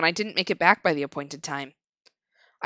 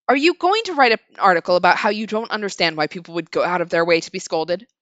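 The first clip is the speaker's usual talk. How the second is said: accusing scold; 3 semitones lower, 7 dB louder than usual. Acted. Woman accuses narrator.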